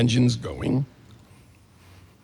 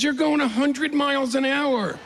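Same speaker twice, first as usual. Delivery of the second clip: instructor suddenly scared, pitched up; panicked warning; 13 semitones higher than usual. Retold or imitated.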